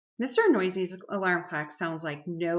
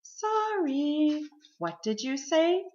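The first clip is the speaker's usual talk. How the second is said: higher, sing-song feigned puzzlement; pretending not to hear; 9 semitones higher, 4 dB louder, slower than usual. A narrator's reading. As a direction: play innocently hard of hearing. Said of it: "pretending not to hear".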